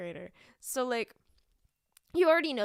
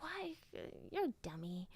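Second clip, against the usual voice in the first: quiet aside; 3 semitones higher, 10 dB quieter than usual. Retold or imitated. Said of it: "I was just like".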